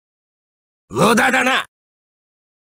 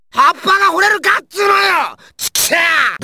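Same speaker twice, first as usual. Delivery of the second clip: high-pitched enraged shout; angry outburst; 5 semitones higher than usual. Acted. Ranting over a nonsensical idiom, furious shout. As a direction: explode in exasperated fury.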